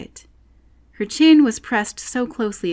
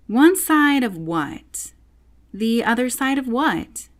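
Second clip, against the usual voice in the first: puzzled; 6 semitones higher, 3 dB louder, slower than usual. A narrator's reading. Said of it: "thought Alice to herself".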